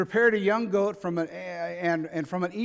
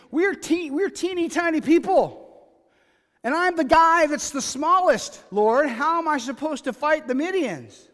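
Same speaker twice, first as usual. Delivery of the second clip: high, loud pleading as Gideon; exasperated, high-pitched imitation of an underdog; mock protest; 9 semitones higher, 7 dB louder than usual. Paraphrasing Gideon's protest of being small.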